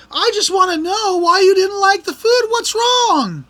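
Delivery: high, loud mock waiter voice, clearly put on; pushy head waiter. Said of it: "he's all like".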